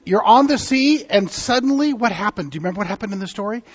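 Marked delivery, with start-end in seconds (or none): none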